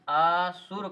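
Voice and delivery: असुर with a long अ held apart, then सुर; split word. Shown as a demonstration. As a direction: stretch and separate the first sound of असुर: "अ... सुर". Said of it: "अ को अलग करके बोल दिया जाए". (असुर में अ को खींचकर अलग बोलें)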